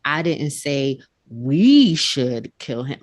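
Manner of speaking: "we" punched, slow and deliberate; stress on we. Shown as a demonstration. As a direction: lean on "we" as if someone else did. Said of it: "he put more emphasis on one word".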